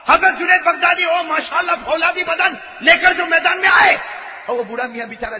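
fiery preaching shout; arena shout. Acted. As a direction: shout it out like the crowd is roaring. Preacher builds the wrestling arena.

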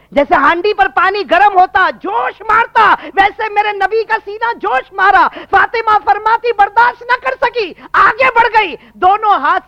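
high, loud, driving sermon delivery; full-force preaching. Acted. Preacher likening the Prophet's breast to a boiling pot, full force. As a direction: cry out each phrase at a fervent peak.

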